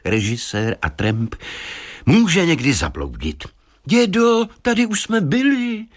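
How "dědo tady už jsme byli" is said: put-on high-pitched little boy; child's voice. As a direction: pipe up high like a young grandson. Child character calls out to grandpa. (vysoký dětský hlas)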